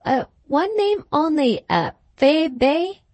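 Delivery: put-on hesitant valet in a mild French accent. A narrator's reading. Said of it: "Toff answered in his foreign accent".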